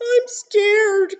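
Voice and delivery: high, loud frightened bee; scared cry. Acted. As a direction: wail it like a lost little bee. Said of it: "cried the greedy bee".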